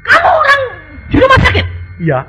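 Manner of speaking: high, lively comic voices; animated retold dialogue. Preacher retelling the doctor and healed patient's amazement, high and lively. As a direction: act out the surprised doctor and patient. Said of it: "retold hospital dialogue voices".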